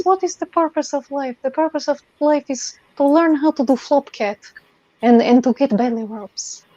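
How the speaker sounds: effeminate Eastern European guy voice; vaguely Russian accent. Acted. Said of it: "like, an effeminate Eastern European guy".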